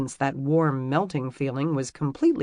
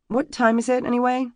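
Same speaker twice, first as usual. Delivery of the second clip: flustered; 9 semitones higher, 4 dB louder than usual. Acted. Flustered question.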